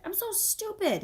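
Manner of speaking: put-on boy berating himself; self-scolding. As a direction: kick yourself in a high voice.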